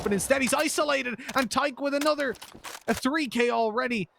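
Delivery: high, driving esports caster call; live play-by-play. Real, not acted. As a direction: ride the action as the kills land.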